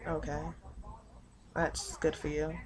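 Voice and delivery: soft, subdued "good for you" reply; understated retold reply. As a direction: say it quietly, with pauses between phrases. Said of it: "And I'm like".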